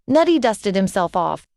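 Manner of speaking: usual speaking voice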